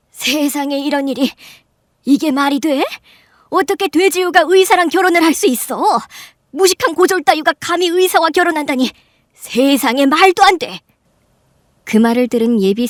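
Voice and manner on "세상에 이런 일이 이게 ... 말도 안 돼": high, loud, overexcited exclamations; excited outburst. Acted. Aunt gaping at the business card, excitedly incredulous. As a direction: babble in envious shock, pitch shooting up. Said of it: "흥분한 나머지 ... 웅얼거렸습니다".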